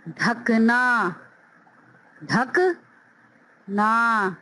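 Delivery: ढकना said slowly then ढक, ना apart; slow syllable split. Shown as a demonstration. Teacher breaking ढकना into syllables. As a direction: split the word. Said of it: "ठ की sound आ रही है".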